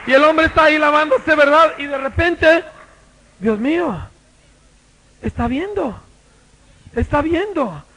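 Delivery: loud, high preacher telling the story; fiery preaching. Acted. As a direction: preach it at full force. Preaching at full force. (predicación fogosa a todo volumen)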